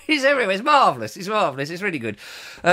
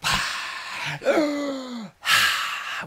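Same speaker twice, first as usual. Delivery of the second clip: deep breathing; 7 semitones higher, 5 dB quieter than usual. Shown as a demonstration. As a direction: take big demonstrative breaths. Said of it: "Deep breathing, [name]".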